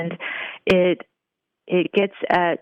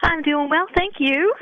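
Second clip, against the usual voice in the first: put-on playful Southern belle accent, pitched high; 11 semitones higher than usual. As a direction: put on a sweet Southern drawl. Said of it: "How's that for my Southern accent".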